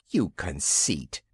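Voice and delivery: a touch of low, slow indignant reproach; understated indignant girl; mild indignation. A narrator's reading. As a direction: scold him lightly for boasting. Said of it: "exclaimed Wendy indignantly".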